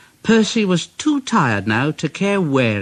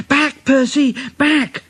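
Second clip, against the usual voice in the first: higher urgent call; higher alarmed cry; urgent driver; 10 semitones higher than usual. A narrator's reading. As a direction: call him back in alarm. Driver urges Percy back.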